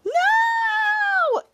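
very high, piercing shouted no; super scream. Retold or imitated. Shadow super screams at Miles, very high piercing no. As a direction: scream it out at full power. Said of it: "She super screams him".

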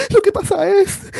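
mocking a crying star on TV. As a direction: wail it like a teary celebrity. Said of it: "empezó a llorar como una estúpida".

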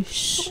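soft imitated hush; shushing. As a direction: shush someone who is praying. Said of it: "people telling you". (chistido suave para callar)

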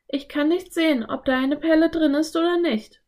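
put-on high girl character voice; Anne. A narrator's reading. Anne peers into the shell, high character voice.

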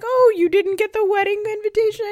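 high, loud put-on relative voice; innocent aunt voice. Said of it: "they're like".

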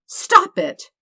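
high, loud, laughing plea; laughing protest. Acted. Dirk begging Todd to stop laughing, giggling high. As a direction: squeal it out through giggles.

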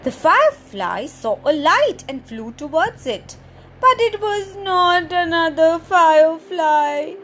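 put-on lively storybook reading for little kids; story narration. Presenter reading a picture book to children, animated storytelling. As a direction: read it out like story time, big and bright.

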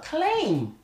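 a high, loud, disbelieving "Claim?"; incredulous echo. Real, not acted. Repeating his friend's word in disbelief, incredulous. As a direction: throw the word back in disbelief. Echoing an outrageous phrase.

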